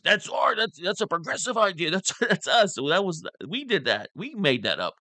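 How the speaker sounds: high-pitched mock indignant claim of credit; Cenk. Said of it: "Cenk says".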